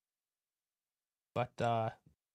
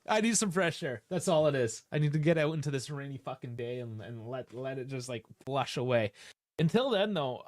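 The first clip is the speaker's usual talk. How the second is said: ordinary talk, nothing marked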